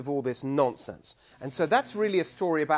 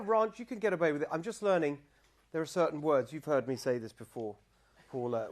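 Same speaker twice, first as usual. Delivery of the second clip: usual speaking voice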